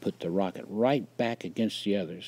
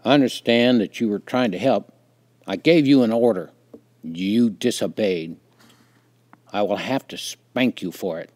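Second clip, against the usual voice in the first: lightly voiced stern father, louder than usual; 9 dB louder than usual. A narrator's reading. Father telling a child who disobeyed of punishment, stern. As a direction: lay down the law firmly as a father.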